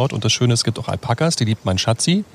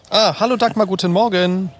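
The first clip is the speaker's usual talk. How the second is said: fröhliche Begrüßung; 9 semitones higher than usual. Real, not acted. Begrüßt Freundin am Telefon.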